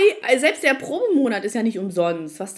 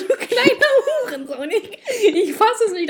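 much higher, slow, laughing mock outrage; hearty insult; 11 semitones higher, 5 dB louder, slower than usual. She vents at the rude agent, loud and laughing. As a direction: let the insult rip with a laugh. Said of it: "aus vollem Herzen gesagt".

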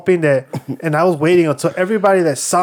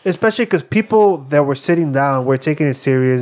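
ordinary delivery

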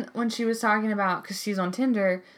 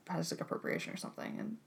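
whisper; 4 semitones lower, 15 dB quieter, faster than usual. Real, not acted. Backing off, dropping voice.

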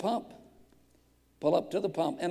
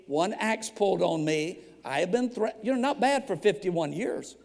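usual speaking voice

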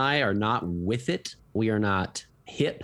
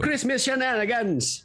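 "Christmas Shenanigans" in a high, slow, hammed-up Irish lilt; Irish accent; 11 semitones higher, slower than usual. Podcaster tries the play title in a mock Irish accent. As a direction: lay the Irish accent on thick, pitched way up. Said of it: "That's my Irish accent, apparently".

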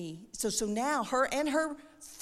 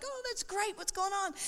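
high, gushing re-enacted reply, softer; bubbly reply; 10 semitones higher, 4 dB quieter than usual. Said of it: "all bubbly. And, and I'm like".

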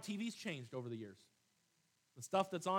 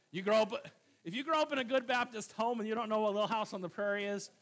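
preaching; 5 semitones higher, 8 dB louder than usual. Acted. Preaching builds again.

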